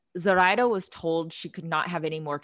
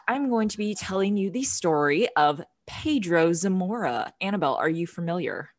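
low sultry voice. Real, not acted. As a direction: purr it like a perfume ad. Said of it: "I'm doing this, like, low, sultry voice today".

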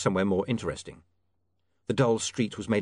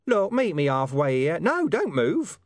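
exasperated; 6 semitones higher, 4 dB louder, slower than usual. Acted. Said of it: "Something sighed".

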